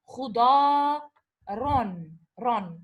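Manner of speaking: khuda loud, then ron, ron falling; syllable by syllable. Shown as a demonstration. Teacher spelling out the tanween for the class: syllable by syllable. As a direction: break khuda, ron into beats. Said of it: "Because I made this tanween".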